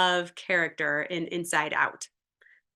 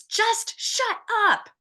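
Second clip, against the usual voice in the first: much higher, slow mock yell; high, drawn-out teen yell; 14 semitones higher, slower than usual. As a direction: shriek it at the parents. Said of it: "she yells to her parents".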